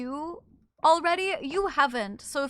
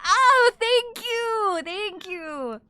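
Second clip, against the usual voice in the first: delighted thanks; 9 semitones higher, 8 dB louder, much slower than usual. Real, not acted. Streamer thanked for compliment.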